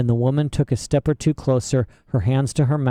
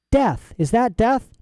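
Lyra's voice; 10 semitones higher, slower than usual. A narrator's reading. Lyra guessing the meaning.

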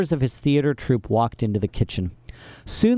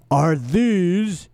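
bald henchman voice; 6 semitones higher than usual. A narrator's reading.

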